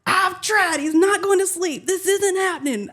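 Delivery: high-pitched exasperated mock protest; panicked new dad. New dad telling wife baby won't sleep, mock panic. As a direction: imitate a husband at his wits' end. Panicked husband answers, retold.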